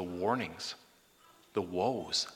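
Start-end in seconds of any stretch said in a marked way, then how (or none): none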